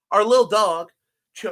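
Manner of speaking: normal talking voice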